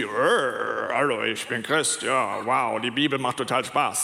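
low, uncanny parody of a believer. Pretend. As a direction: sound creepily empty while claiming joy. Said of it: "ja, oder ganz creepy".

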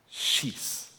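a breathy whispered order to shoot; whispered "Schieß", barely voiced; whispered command. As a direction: hiss the command under your breath. Prophet orders the shot.

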